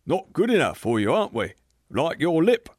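squaring up for a fight; threatening. Acted. Big man turns on short man.

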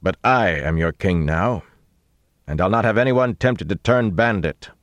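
booming. A narrator's reading. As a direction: address hidden men so every word carries. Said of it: "they boomed against the wall".

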